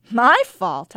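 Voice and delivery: a laughing, disbelieving question; incredulous laugh. A narrator's reading. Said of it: "I laughed incredulously".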